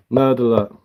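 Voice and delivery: ordinary talk, nothing marked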